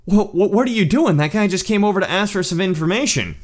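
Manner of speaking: high-pitched, stammering disbelief; imitated baffled scolding; shocked rebuke. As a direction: act out friends taking someone to task. Friends acted out rebuking him.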